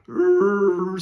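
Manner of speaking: loud mock sobbing groan; exaggerated crying urgh; retold sob.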